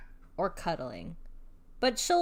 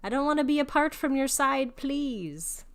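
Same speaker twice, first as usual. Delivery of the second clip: higher, louder pleading pet voice; needy cat voice; 6 semitones higher, 5 dB louder than usual. Pretend. Owner voicing her clingy cat begging for hugs, higher and pleading. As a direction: beg for cuddles like a clingy cat. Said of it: "my other one's like".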